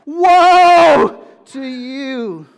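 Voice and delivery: full-throated prophetic cry of woe; forceful woe. Shown as a demonstration. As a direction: thunder it like a prophet's curse. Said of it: "give you the force of what Jesus is saying".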